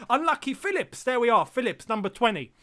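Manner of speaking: excited call. Real, not acted. Streamer calls out a Morecambe player, excited. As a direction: get hyped on the call.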